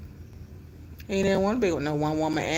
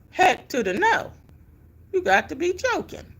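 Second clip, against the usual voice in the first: higher, incredulous "heck no"; indignant exclamation; 10 semitones higher than usual. Real, not acted. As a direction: refuse it with rising disbelief. Outraged rejection of staying.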